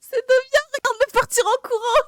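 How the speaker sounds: shrieking high with glee; excited laughing shout. Real, not acted. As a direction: yell it out, cracking up. Teammate hit, laughing shout.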